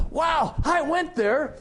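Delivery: high, slow, loud mock squeal of surprise; excited imitation. Retold excited reaction to shared college.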